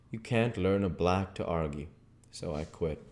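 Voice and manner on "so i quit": plain reading voice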